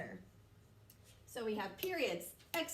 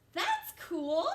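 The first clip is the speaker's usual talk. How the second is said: higher, louder, excited; exclamation read; 6 semitones higher, 6 dB louder than usual. Shown as a demonstration. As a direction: say it with an exclamation point. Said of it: "as they want to be read with the question mark and the exclamation point".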